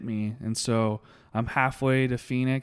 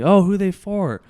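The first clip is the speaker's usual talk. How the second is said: higher, louder imitation of a bartender; perky mock-bartender question, voice pitched up; curious bartender; 9 semitones higher, 10 dB louder than usual. Bartender asks the drinker about his order, curious and loud.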